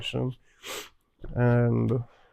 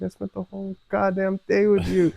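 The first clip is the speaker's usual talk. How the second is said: exasperated; 7 semitones higher, 3 dB louder than usual. Retold or imitated. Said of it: "And I was like".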